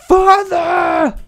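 loud, very high drawn-out wail; mock tragic cry. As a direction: cry out for a lost father, hammed up. Mimics Lion King cry.

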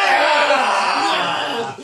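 loud triumphant yell; victory shout. Real, not acted. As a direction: cheer the win. Wins rock paper scissors.

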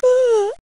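high, soft waking yawn; sleepy yawn. Acted. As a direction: yawn as you wake up.

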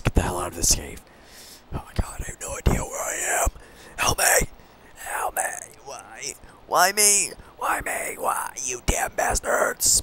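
hushed, breathy mock-desperate whisper; frightened whisper. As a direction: whisper as if lost in the dark. Whispering through the dark cave.

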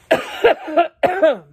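real loud choking cough; hacking cough. Real, not acted. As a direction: hack it out hard. Pepper dust in throat.

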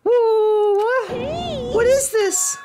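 very high cartoon yelp; startled high-pitched character. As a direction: squeak in bewildered alarm. Alarmed game character in put-on voice.

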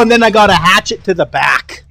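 ordinary talk

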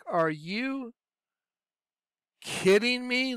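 retold disbelief, pitch climbing; imitating an incredulous confrontation. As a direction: act out a stunned, drawn-out question. Acted disbelief at her lie.

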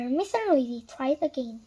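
plain reading voice

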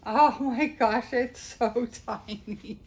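loud gush breaking into a laugh; delighted laugh. Real, not acted. Vlogger holding a tiny outfit, loud laughing gush. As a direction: laugh through the words.

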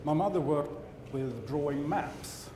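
usual speaking voice

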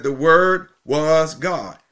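"Word" and "was" drawn out slowly, each weighted; slow right down and lean on "Word was"; deliberate, weighty "The Word was God"; emphatic stress. Shown as a demonstration. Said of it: "The emphasis is on who the Word is".

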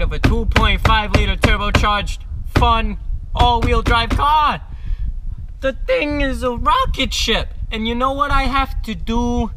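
mock-angry Italian-American accent, loud and high; angry Jersey mobster. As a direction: hammer each phrase like an exasperated mobster dad. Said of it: "my terrible Jersey Italian mobster, angry Wolf of Wall Street dad accent".

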